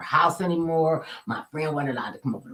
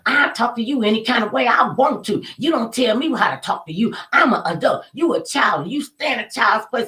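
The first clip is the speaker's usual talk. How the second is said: put-on higher-pitched, haughty scolding of a child; indignant adult scolding; 6 semitones higher, 4 dB louder than usual. Adult lady scolding the young narrator, indignant and haughty. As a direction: put the child in her place.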